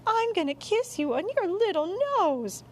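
very high, gushing pet coo; baby-talk a cuddled cat; doting mother. Acted. Mom about to kiss the cat's nose, cooing high.

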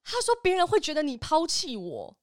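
ordinary talk, nothing marked

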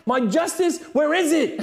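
loud, high mock shout at God; angry at God. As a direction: demand your justice like Job, angry. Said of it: "like, angry at God".

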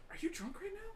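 shocked whispered question. Acted. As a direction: whisper it so the church can't hear. Pastor whispers to wife.

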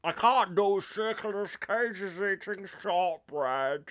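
slow, drawn-out mock Nicolas Cage; a slowed, hammed-up Nicolas Cage; bad Nicolas Cage impression. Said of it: "do an impression of".

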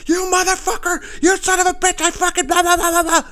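high, loud, furious screaming of insults. Retold or imitated. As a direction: scream it like she's gone ballistic. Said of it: "she went fucking ballistic on me".

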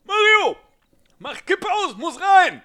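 high, loud shouted call; shouting. Retold or imitated. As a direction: yell it across the field. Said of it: "schreit Otto Rehhagel zur Eckfahne".

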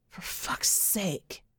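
slow whispered curse under the breath; exasperated whisper. Acted. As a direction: hiss it to yourself in frustration. Sam's exasperated inner thought.

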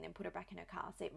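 ordinary talk

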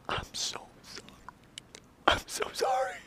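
whispered, broken apology; tearful whisper. Acted. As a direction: whisper it like she's been crying. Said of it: "She came shaking, bawling".

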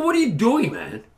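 high-pitched, baffled objection dropping at the end; retold indignant protest. Man to officer handcuffing him, indignant. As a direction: protest in disbelief as if being cuffed.

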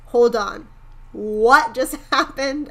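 retold loud astonishment; all-caps disbelief. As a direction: blurt it in capital letters, dragging out the question. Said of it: "like, all caps".

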